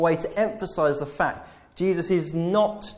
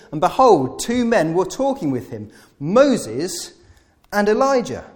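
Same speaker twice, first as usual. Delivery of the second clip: raised, louder reading of the verse; scripture reading; 5 semitones higher, 6 dB louder than usual. Acted. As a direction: read the verse out to the church. Reads scripture aloud in sermon.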